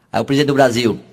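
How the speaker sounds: normal talking voice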